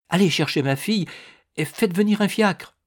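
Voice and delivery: high, agitated command; distraught, high-pitched insistence; urgent plea. A narrator's reading. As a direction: order it on the edge of nerves. Woman near hysterics orders him urgently.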